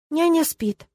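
put-on high-pitched little boy voice; small child answering timidly; high, meek childlike murmur; timid babble. A narrator's reading. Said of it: "тихо пролепетал он".

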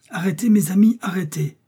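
high, loud plea to stop; urgent halt. Acted. Doctor stops his friends rushing out, urgent. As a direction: call them back sharply, pitch jumping up.

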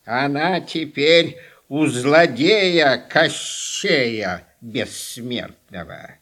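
low, slow, creaky crone; old witch voice. Acted. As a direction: drawl it out like Baba Yaga. Baba Yaga warns about Koschei.

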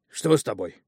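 loud, alarmed concern; suddenly sober with worry; worried. Acted. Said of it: "забеспокоился Эпимас".

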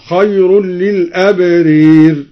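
للأبرير with the long ā leaned toward ē; imāla on al-abrār. Shown as a demonstration. Teacher showing imāla on للأبرير, slow recitation. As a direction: bend للأبرير toward i, slowly. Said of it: "وأمال".

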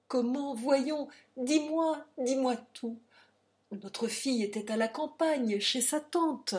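plus haut et plus fort, insistant; pressant et inquiet. A narrator's reading. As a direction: exige la réponse, inquiet. Père presse Adrienne de parler. (père inquiet presse sa compagne, voix haute)

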